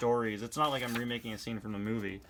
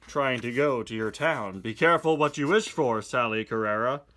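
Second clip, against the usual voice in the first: ordinary delivery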